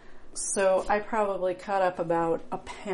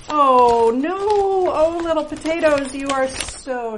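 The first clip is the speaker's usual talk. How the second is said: high, loud dismay over the spuds; a real little gasp of dismay, much higher; dismayed cry; 7 semitones higher, 10 dB louder than usual. Real, not acted. Finding sprouted potatoes, she cries out in dismay.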